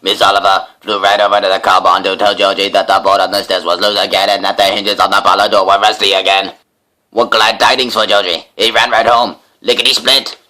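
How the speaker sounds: plain reading voice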